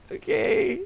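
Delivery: high laughing okay; mock awkward okay. To a friend thrilled by the views, a high laughing okay. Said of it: "I'm like".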